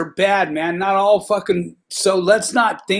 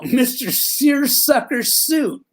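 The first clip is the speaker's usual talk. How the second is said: slow, higher, drawn-out teasing title; mocking nickname; 8 semitones higher, much slower than usual. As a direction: stretch the nickname out to tease the officer.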